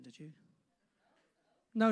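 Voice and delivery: ordinary delivery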